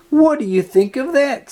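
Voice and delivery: high, indignant question; Sally surprised. A narrator's reading. Sally watching the beagle pass by, indignant and high. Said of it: "Sally said".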